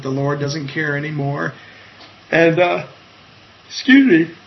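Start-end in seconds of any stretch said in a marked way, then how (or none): 2.3-4.3 yawning. Real, not acted. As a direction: excuse me said through a real yawn, higher and louder. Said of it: "I'm sorry, I'm yawning".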